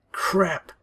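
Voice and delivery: breathy whispered "crap"; whispered curse. Acted. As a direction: curse under the breath in alarm. Phone slips from her hand.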